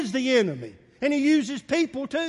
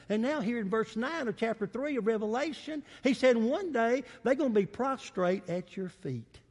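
ordinary talk, nothing marked

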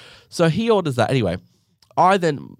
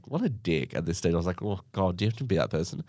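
softer muttered inner thought; muttered aside; 3 semitones lower, 7 dB quieter, faster than usual. Retold or imitated. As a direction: say it under your breath, exasperated.

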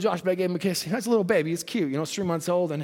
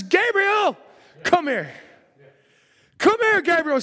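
God calling; 8 semitones higher, 4 dB louder, slower than usual. Acted.

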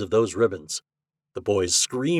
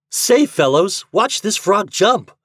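put-on high, loud boyish call; excited cry; 11 semitones higher, 7 dB louder, slower than usual. A narrator's reading. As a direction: call friends to come watch. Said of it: "cried Fat".

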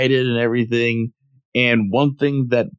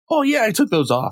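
high, cheerful admission; casually innocent kid; carefree Goku voice; 11 semitones higher than usual. Retold or imitated. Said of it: "He's like".

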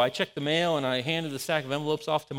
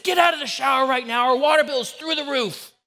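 much higher and louder, banging-on-the-door order; yelling at son; 8 semitones higher, 7 dB louder than usual. Retold or imitated. Father yelling at his son through the bathroom door. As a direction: bark it like an angry dad. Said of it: "I sounded so much like my dad".

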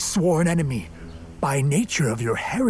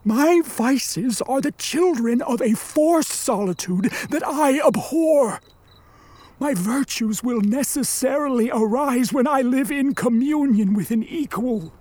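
raised pitch, earnest argument; impassioned plea; 5 semitones higher than usual. Acted. Monster to distrustful creator, impassioned plea. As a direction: argue your case with passion. Monster argues his case.

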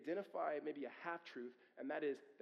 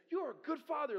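higher and louder, gushing compliment; mock praise; 11 semitones higher, 6 dB louder than usual. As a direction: gush it like an admirer. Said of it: "when they actually say".

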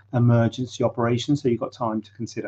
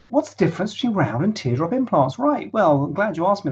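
big fancy microphone; 7 semitones higher than usual. Real, not acted. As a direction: reread on the better mic. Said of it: "take two".

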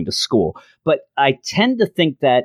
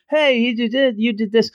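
much higher, mock-accusing voice; accusing vice principal; 10 semitones higher than usual. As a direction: point the finger like a fussy vice principal.

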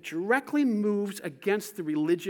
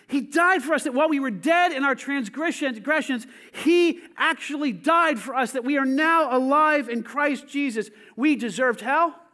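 1 preaching fervor; 4 semitones higher, 6 dB louder than usual. Real, not acted. Preaching at full force.

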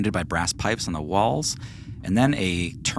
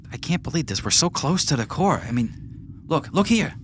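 higher, keen voice pressing on; frustrated eager NPC; 8 semitones higher than usual. Acted. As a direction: urge them to look. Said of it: "NPC reacts".

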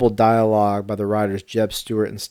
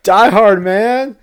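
higher and louder, bursting with glee; excited exclaim; 10 semitones higher, 9 dB louder, slower than usual. Real, not acted. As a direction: announce it like a triumphant reveal. Proudly names his Christmas movie pick.

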